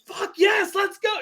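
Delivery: cheering the knockout at a watch party; ecstatic shout. Retold or imitated. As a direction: whoop like your friend just scored the knockout. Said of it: "And I was like".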